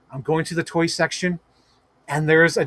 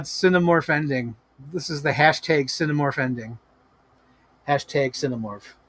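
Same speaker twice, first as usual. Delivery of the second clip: normal talking voice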